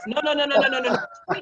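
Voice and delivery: usual speaking voice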